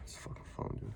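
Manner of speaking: sighing, muttered under breath; muttered curse. Real, not acted. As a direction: grumble at the phone quietly. Phone trouble again, muttered.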